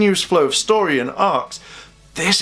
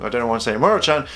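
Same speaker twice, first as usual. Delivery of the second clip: ordinary talk, nothing marked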